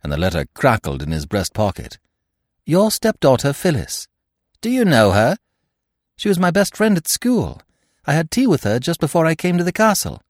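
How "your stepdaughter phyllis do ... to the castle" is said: put-on higher young woman's voice; bright, direct young lady speaking to her friend's stepfather; Eve's voice. Eve voiced, higher pitch.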